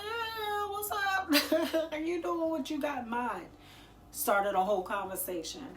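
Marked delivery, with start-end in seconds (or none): 0.0-3.5 much higher, eager and chirpy with a laugh; excited reply. Retold or imitated. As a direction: answer like you're thrilled to hear from her. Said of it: "And I was like".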